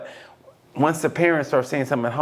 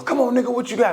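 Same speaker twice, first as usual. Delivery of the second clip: high-pitched retold taunt; put-on fight challenge, raised pitch; mock challenge; 7 semitones higher than usual. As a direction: imitate someone squaring up, pitched up. Repeats the imitated fight challenge.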